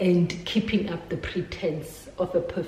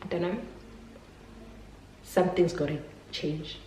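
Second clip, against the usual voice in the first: gentle warning; 4 dB quieter than usual. Retold or imitated. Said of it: "my mom was like".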